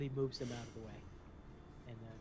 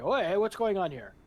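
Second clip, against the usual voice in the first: guard calling out; 7 semitones higher, 11 dB louder than usual. Acted. Guard stops them at gate.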